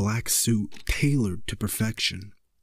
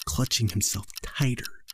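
plain reading voice

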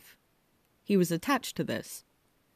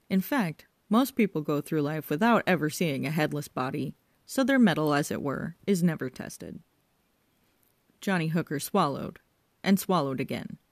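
ordinary delivery

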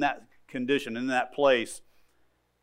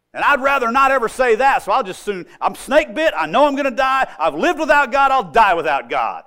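defiant sinner; 6 semitones higher, 5 dB louder than usual. Retold or imitated. Hard-hearted sinner voiced defiantly.

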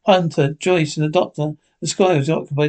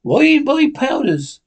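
much higher, slow and louder; John cries out; 9 semitones higher, 3 dB louder, slower than usual. A narrator's reading. Silver questioning the sailors, slow high cry. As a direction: draw the question out in alarm. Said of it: "cried John".

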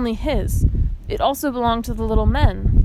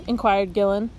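plain reading voice

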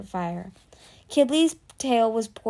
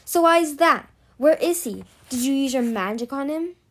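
demanding; 5 semitones higher, 4 dB louder than usual. Acted. Interrogating pinned prisoner.

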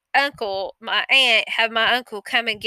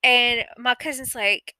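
ordinary talk, nothing marked

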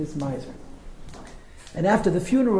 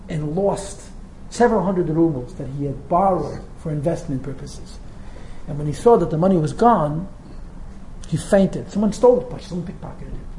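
normal talking voice

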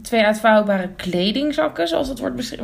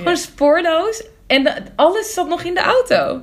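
baffled; 6 semitones higher than usual. Real, not acted. Baffled exclamation.

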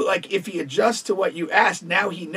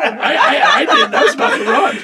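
laughing; 7 semitones higher, 7 dB louder than usual. Real, not acted. Cracking up at friend's girl voice.

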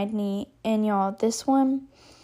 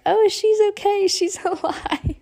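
high-pitched mock cheer from a watching friend; relieved friend; 9 semitones higher than usual. Friend behind her spots the thumb up, mock relief. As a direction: call it high, stretching the last word.

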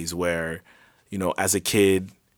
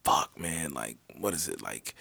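low, soft, trailing off; acted reluctant grumbling; 6 semitones lower, 12 dB quieter than usual. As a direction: grumble it to yourself, sinking lower. Acting out friends weighing going out.

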